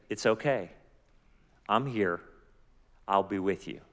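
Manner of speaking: low, soft, comforting voice; gentle reassurance. Acted. Preacher voicing God to someone in a valley, gentle reassurance. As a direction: reassure them softly, leaving room between phrases.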